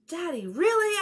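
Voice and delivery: high, loud disbelieving whine; exasperated child. Acted. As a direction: protest at dad, pitched high and loud. Girl exasperated with Daddy.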